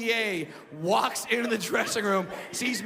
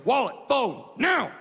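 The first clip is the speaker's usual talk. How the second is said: barked demand, louder and higher; mugger's demand; 3 semitones higher, 4 dB louder, slower than usual. Retold or imitated. Mugger demands wallet and phone in a loud bark. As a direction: snap it like a mugger holding a gun. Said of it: "And the guy goes".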